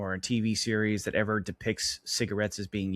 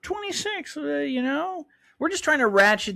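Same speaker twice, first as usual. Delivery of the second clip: high cutesy voice. Pretend. Echoing cohost's cutesy voice.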